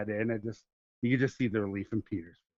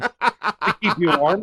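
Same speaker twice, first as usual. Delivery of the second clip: louder, higher, drawn out through laughter; laughing; 6 semitones higher, 6 dB louder, much slower than usual. Real, not acted. Joking with friends about hotel blankets, laughing. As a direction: stretch it out, cracking up.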